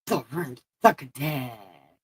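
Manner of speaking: a light lisping demo of her sore-mouth speech; Sylvester the cat lisp. Shown as a demonstration. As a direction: lisp it like a toothless cartoon cat. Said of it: "Everything sounds like Sylvester the cat".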